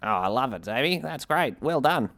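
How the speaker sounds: lightly put-on voice of an approving public; Voice of Public Opinion. Giving co-host a sample of the approving public's voice. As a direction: play an admiring member of the public, pitch lifting as the praise goes on. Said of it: "it's called The Voice of Public Opinion".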